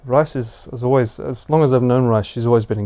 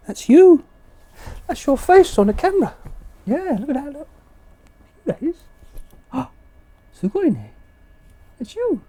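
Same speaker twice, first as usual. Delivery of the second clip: pet voice; 12 semitones higher than usual. Real, not acted. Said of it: "Pet voice".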